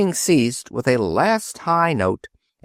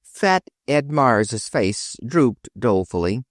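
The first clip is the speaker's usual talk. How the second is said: ordinary delivery, nothing marked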